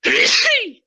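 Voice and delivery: loud sudden sneeze; a big real sneeze; sneezing. Real, not acted. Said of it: "I'm sorry, I'm going to sneeze".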